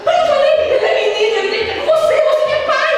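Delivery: high, put-on nagging voice with a laugh; mock-nag the father, laughing; Mary blaming José, hammed-up nagging; stressed mother. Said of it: "Já começa a falar".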